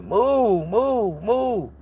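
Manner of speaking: high, drawn-out pestered whine; acted annoyance. Speaker imitates someone blocked from the TV, annoyed. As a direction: stretch each word high, mimicking annoyance.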